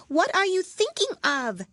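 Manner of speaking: high, loud, stern squeak; severe mouse. A narrator's reading. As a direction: scold like a tiny strict teacher.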